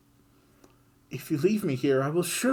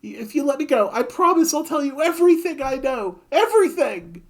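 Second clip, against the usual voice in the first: much higher, loud frantic babbling; panicked pleading; 8 dB louder than usual. Acted. Griffin begging Kid for mercy, high frantic panic. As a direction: grovel for your life.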